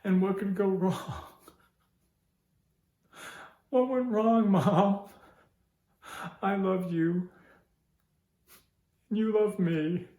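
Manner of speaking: high, loud, pleading cry; anguished crying out. Acted. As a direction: cry out to her in pain. Grief-stricken plea to Mom.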